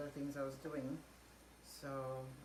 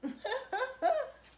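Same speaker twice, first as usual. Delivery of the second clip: high, loud laughing fit; loud burst of laughter; 10 dB louder than usual. Real, not acted. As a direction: laugh out loud, real delight. Laughing at the husband's lipstick.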